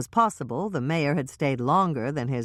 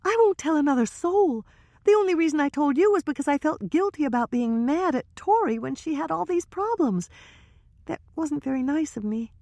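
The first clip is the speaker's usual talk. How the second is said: put-on higher young woman's voice, hushed gossip; Trisha's voice; 13 semitones higher than usual. Trisha confiding to Hannah and Michelle, higher nervous voice.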